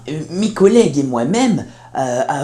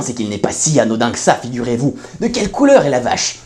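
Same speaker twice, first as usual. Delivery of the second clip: ordinary delivery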